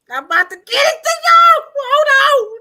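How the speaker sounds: high, loud excited shout. Real, not acted. Hurrying to play the hammer clip, high excited shouting. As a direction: yell it out, racing to get it ready. Host frantically readies a clip.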